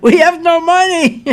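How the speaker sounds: loud, high mock cry breaking into laughter; laughing mock announcement. Acting out failed banks at window.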